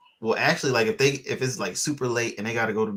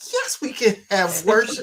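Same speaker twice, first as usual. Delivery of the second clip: mock cheerful answer; 10 semitones higher, 5 dB louder than usual.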